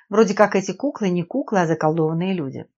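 plain reading voice

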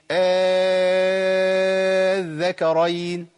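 very long, steady held "aa" before الذكرين; six-count madd. Shown as a demonstration. Teacher showing substitution with alif, long madd into الذكرين. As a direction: hold آه out at length, then land on الذكرين. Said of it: "لازم أمد ست حركات".

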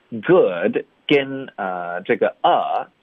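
ordinary delivery

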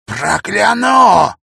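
very slow, high shouted curse; menacing curse. Acted. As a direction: drag out the threat. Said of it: "зашипел он". (зловещее проклятие)

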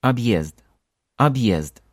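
Объезд said abyezd, clear ye; Объезд: ye in the middle; Ye after hard sign. Shown as a demonstration. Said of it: "presence of hard sign".

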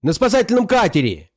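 high, urgent follow-up cry; excited high-pitched report; alarmed shout. A narrator's reading. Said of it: "закричал он".

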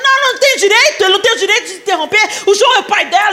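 shouting. Retold or imitated. Brother shouting in family quarrel.

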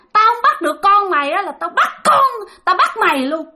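high-pitched, menacing demon tirade; shrieky acted outburst from a vengeful spirit; angry ghostly scolding. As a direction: snap and threaten in a high possessed voice. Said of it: "Thì bà quát nạt lên".

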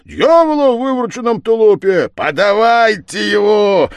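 high, loud commanding yell; shouted order. Acted. Village head demands the prisoner, high loud shout. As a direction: bellow it, stretching the final command. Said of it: "закричал голова".

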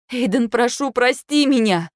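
high, pleading plea; wife begging husband's forgiveness. A narrator's reading. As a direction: beg him through rising panic.

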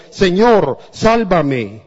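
loud desperate cry to the Lord; shouting for help. A narrator's reading. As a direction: call out loud, begging to be saved. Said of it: "Entonces gritó".

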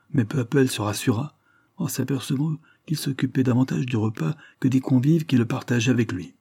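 ordinary delivery, nothing marked

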